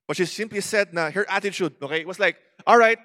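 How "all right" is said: "All right" called out loud and high; loud acted resolve. The woman boldly tells Jesus all right. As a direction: declare it loud, bold and willing.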